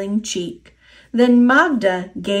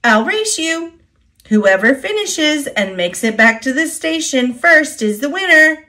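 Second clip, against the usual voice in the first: eager challenge; 6 semitones higher than usual. A narrator's reading. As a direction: throw down a race challenge. Emily challenges Thomas to race.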